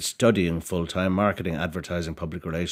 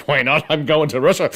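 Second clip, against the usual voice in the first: higher, louder burst of excitement; gleeful resolve; 8 semitones higher, 7 dB louder than usual. Retold or imitated. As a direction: blurt out the retold decision with delight. Retells his bold sudden decision.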